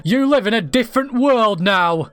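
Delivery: put-on high, loud, eager girl; bubbling over with a schoolgirl crush; excited little girl voice. Young girl plotting a love letter, high and eager.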